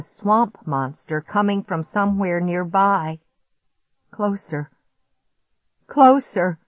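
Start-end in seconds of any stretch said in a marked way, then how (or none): 4.1-4.7 higher, held-back "Closer"; suspenseful first warning; mounting dread. A narrator's reading. Monster's footsteps draw near, first take. 5.9-6.6 high, loud "Closer"; alarmed. A narrator's reading. Monster's footsteps draw near, louder repeat.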